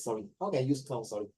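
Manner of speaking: usual speaking voice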